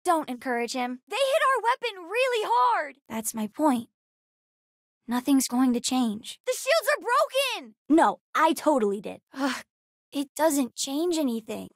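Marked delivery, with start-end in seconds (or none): 1.1-2.9 high-pitched urgent cry; alarmed battle callout. Acted. Child reporting a weapon hit to the crew, alarmed. 6.4-7.8 very high, urgent cry; alarmed battle callout. Acted. Child reporting failed shields in battle, alarmed.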